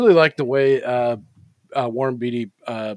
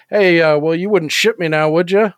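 higher, louder sly frog delivering the punchline; 5 semitones higher, 7 dB louder than usual. Retold or imitated. Frog teasing the eagle in the punchline, higher and louder. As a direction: land the zinger as the cheeky frog. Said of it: "And the frog says".

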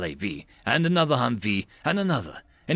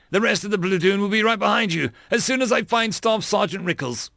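high, urgent raised voice; calling out orders; 7 semitones higher than usual. A narrator's reading. Lieutenant promises to follow his sergeant, calling out. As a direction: keep the order high and pressing.

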